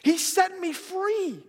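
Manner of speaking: high, loud, jubilant cry with drawn-out words; calling the people over. Retold or imitated. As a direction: shout the good news to the whole town. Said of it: "she's going back to the people".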